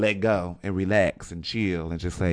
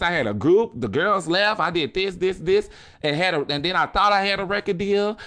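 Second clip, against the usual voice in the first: voicing [name]; 7 semitones higher than usual. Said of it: "now I'm talking about [name]".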